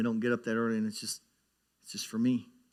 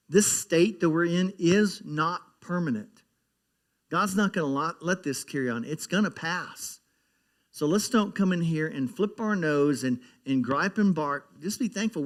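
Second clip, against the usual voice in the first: preaching; 5 semitones higher, 4 dB louder than usual. Acted. Pastor urging the church not to gripe, preaching hard. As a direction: drive it home to the congregation.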